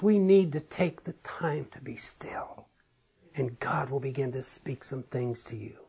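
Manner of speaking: soft, low, near-whispered appeal; hushed. Real, not acted. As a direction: drop to a hush, inviting stillness. Urging the congregation to be still.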